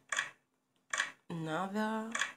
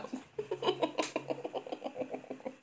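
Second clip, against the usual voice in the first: breathy laugh; 12 semitones higher, 4 dB quieter than usual. Real, not acted. Laughs at game.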